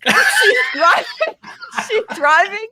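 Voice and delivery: laughing through the words; high, loud laughing retelling; giggling hard while telling it. Real, not acted.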